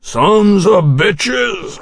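hoarse, boozy curse; drunk and hoarse. Acted. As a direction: growl it out hoarse with drink. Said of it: "hoarse with drink".

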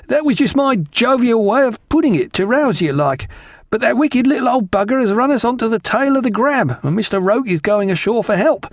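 ship's steward. A narrator's reading. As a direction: play a chatty steward reporting trouble, pitched high. Voices the steward.

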